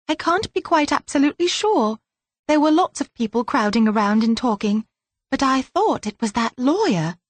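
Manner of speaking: put-on high, breathless child telling a secret; frightened girl blurting it all out; a scared child's fast, high-pitched retelling; little girl voice. Narrator voices child Belinda.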